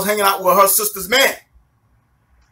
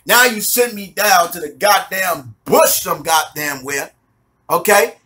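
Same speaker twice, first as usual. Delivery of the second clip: normal talking voice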